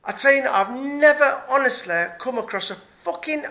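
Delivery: loud, high, furious rant; swearing in a real rage; fuming. Real, not acted. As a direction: let real fury boil over. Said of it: "I'm fucking fuming".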